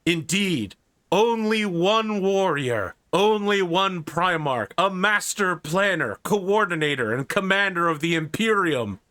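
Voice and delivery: grand host building to a reveal; announcer introduction. Acted.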